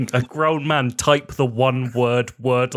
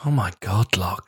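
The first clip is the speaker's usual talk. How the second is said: whisper; 8 semitones lower than usual. Real, not acted. Reacting to the rude-word story.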